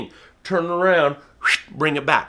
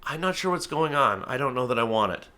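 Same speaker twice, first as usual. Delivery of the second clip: soft, unsure bird voice; hesitant bird; 7 dB quieter than usual. Retold or imitated. A falconer voicing a doubtful hawk at the glove, soft. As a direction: hold back, doubtful and quiet. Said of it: "they're like".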